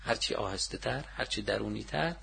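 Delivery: soft, measured phrases with pauses; quiet and inward. Shown as a demonstration. Teacher urging listeners to curse inwardly, softly.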